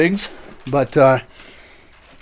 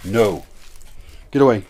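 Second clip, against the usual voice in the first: ordinary delivery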